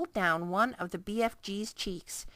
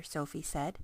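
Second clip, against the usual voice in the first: plain reading voice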